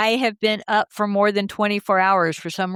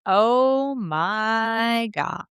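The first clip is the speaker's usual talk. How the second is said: OH MY GOD stretched very slowly, word by word; hammed-up, lingering "Oh my God"; drawn-out inflection; much slower than usual. Shown as a demonstration. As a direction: draw out each word of "Oh my God". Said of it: "put different inflections on it".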